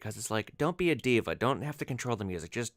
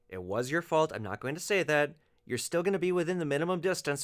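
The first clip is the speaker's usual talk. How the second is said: usual speaking voice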